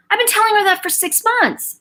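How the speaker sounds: high, exasperated complaint; exasperated mother. Retold or imitated. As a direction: mimic a fed-up mom, pitched high. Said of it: "my friend will call me and go".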